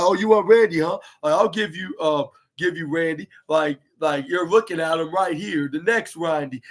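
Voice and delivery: ordinary talk, nothing marked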